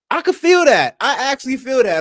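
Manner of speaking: eager, wound-up agreement; excited high agreement. Real, not acted. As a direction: pitch jumps way up.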